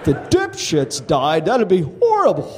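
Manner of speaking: high, lively stage delivery for a laughing crowd; stand-up comedy set. Acted. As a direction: sell each punchline to the room. Stand-up set before interview.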